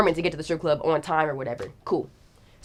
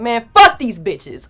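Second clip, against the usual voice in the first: slow, lightly voiced grumpy bodyguard, a bit louder; annoyed driver; 4 semitones higher, 7 dB louder, slower than usual. Hint of the driver ranting about the fans. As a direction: drag it out like an irritated big guy. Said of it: "He's like".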